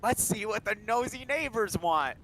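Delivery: high, slow, mock-sinister line; menacing mock voice. Dennis heading to the neighbors with a poker, slow mock menace. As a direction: draw it out like a threat. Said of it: "fucking is like".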